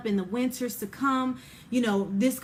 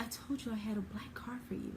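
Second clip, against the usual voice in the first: soft, hushed and a little quick; God's voice at the back of your mind, barely raised; a quiet nudge, not a shout; gentle quiet reminder; 15 dB quieter, faster than usual. Retold or imitated. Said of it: "he's not gonna yell it to you".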